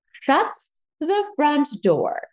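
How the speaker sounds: high, slow, punched-out astonishment; amazed disbelief. Retold or imitated. Bride retelling her reaction to the venue news, amazed. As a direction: draw each word out in playful shock.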